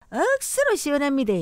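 quick Gyeongsang dialect with a pitch jump; 경상도 사투리. Shown as a demonstration. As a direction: leap up early, Gyeongsang lilt. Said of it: "경상도". (빠른 경상도 사투리 억양)

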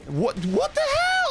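high, slow startled cry; shocked. Real, not acted. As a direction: react in sudden disbelief. Sudden shock in game.

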